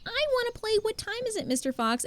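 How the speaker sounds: put-on high eager child voice, then settling; kid asking. Coach imitates little kids begging to play, high and eager. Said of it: "they always go-, say".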